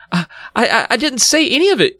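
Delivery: put-on high, flustered girl; acted panicky stutter; stammering. A narrator's reading. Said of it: "Chris stammered".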